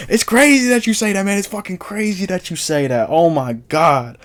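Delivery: put-on high-pitched, animated New Orleans accent; put-on New Orleans accent, high and stretched out; New Orleans local's accent. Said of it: "I'm gonna do the accent".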